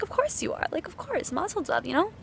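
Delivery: knowing nonchalance. Retold or imitated. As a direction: shrug it off as obvious. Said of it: "And part of me is just like".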